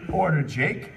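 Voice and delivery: ordinary talk, nothing marked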